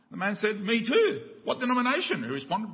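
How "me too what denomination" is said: higher and louder, delighted; thrilled to find a kindred soul; excited stranger. Retold or imitated. Stranger on the bridge thrilled by the answer, higher and louder.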